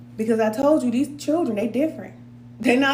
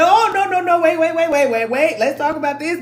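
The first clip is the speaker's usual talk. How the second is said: high, loud frantic pleading over the phone; panicked kid pleading; 6 semitones higher, 6 dB louder than usual. Retold or imitated. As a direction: beg like a teen about to lose his phone. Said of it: "he said".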